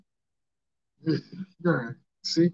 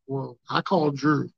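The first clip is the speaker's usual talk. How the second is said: ordinary delivery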